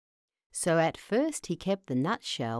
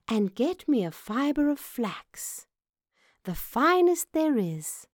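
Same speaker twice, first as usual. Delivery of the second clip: high-pitched mouse princess giving orders; commanding little mouse; 8 semitones higher than usual. Acted. Mouse princess sends servants for flax, commanding.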